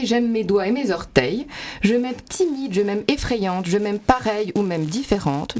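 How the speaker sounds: put-on lightly rhythmic reading of a rhyming children's book; rhythmic rhyme reading. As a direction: read the rhymes with a gentle bounce. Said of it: "construit autour de rimes et c'est très rythmé".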